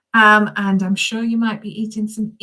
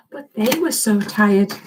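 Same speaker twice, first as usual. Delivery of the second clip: plain reading voice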